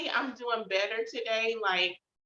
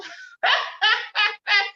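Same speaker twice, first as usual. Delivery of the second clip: a big, high, loud burst of laughter; hearty laugh; 14 semitones higher, 10 dB louder than usual. Real, not acted. Vlogger laughing at her own song reference, loud burst of laughter.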